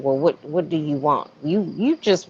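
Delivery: ordinary delivery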